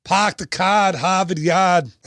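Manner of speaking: put-on Boston accent, dropped r's in car and Harvard Yard; a jokey Boston accent slipped out of a gangster voice; lightly mocking Boston accent. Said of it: "turns into, like, a Boston accent".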